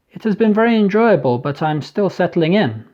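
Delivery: put-on polite young Englishman, British accent; a light British accent for a young man. A narrator's reading. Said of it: "in a perfect British accent".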